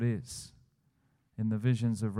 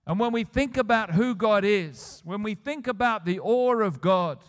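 loud, emphatic preacher, higher pitched; impassioned sermon climax, louder and higher; full-force preaching; 7 semitones higher, 5 dB louder than usual. Acted. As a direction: drive each line home from the pulpit.

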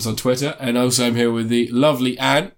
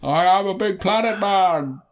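high, slow, swooping mock grandeur; Orson Welles; 7 semitones higher, slower than usual. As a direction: stretch it out like a hammy planet-sized villain. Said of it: "we can watch Orson Welles go".

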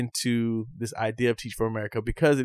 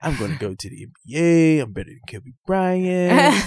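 surfer dude voice; 4 semitones higher than usual. Retold or imitated. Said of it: "talking like this surfer voice dude".

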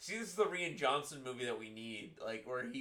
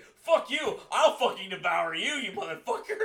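loud, high mock yell breaking into laughter; hammed-up furious threat; 8 semitones higher, 9 dB louder than usual. Imitating a man threatening to devour his son, mock rage. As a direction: bellow the threat with playful overkill. Acting out film character's threat.

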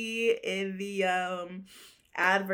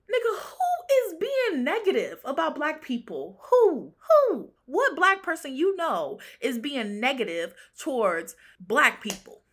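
indignant questioning, pitched up; incredulous outrage; 5 semitones higher, 4 dB louder than usual. Real, not acted. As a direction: demand an answer in disbelief. Indignant reaction to a tweet.

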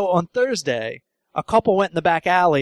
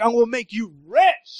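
higher, cocky boast lightly voiced; bragging stranger; 5 semitones higher than usual. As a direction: brag like you own the town. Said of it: "He's like".

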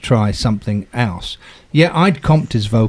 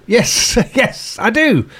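laughing reply; 10 semitones higher, much slower than usual. Retold or imitated. Laughing reply to pompous question.

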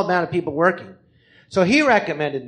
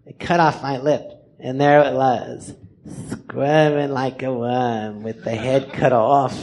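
low, slow lipless war veteran; drawn-out, low weirdo storyteller; Biff Lorenzo; 5 semitones lower, slower than usual. Acted. Veteran tells how he lost his lip, low and slow. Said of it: "he goes".

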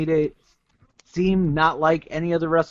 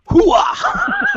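Al Pacino's Hooah, loud and high; loud gung-ho soldier; 5 dB louder than usual. Retold or imitated. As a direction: bark it like a fired-up soldier. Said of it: "A gif of Al Pacino going".

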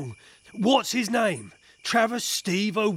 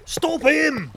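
loud, high yell to stop someone; urgent shout; 6 semitones higher, 4 dB louder than usual. Acted. Officer yelling to stop a fleeing suspect, urgent shout. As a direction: shout it out, voice jumping up.

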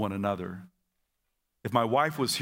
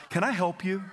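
cheerful offer; 7 semitones higher than usual. Retold or imitated. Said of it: "my wife's not the only one".

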